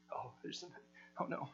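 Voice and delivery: barely voiced, sinking into dismay; very soft mock gasp of dread; hushed dread. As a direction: breathe it out as the bag gives nothing. Brothers dreading each bag searched.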